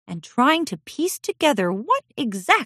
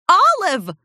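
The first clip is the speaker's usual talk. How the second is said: much higher, louder scolding call; reprimanding; 12 semitones higher, 6 dB louder than usual. Acted. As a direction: snap her daughter's name. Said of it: "Lilac Quartz reprimanded".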